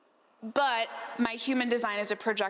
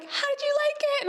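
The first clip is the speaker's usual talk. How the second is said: much higher, sing-out friendly question; mock-sweet friend checking in, pitched way up; imitated chirpy friend. Imitating friend after the sound bath.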